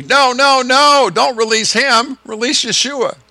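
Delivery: loud, high crowd cry of refusal; crowd shouting. Retold or imitated. Preacher voicing the crowd refusing Pilate, loud high shout. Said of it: "the crowd is gonna say".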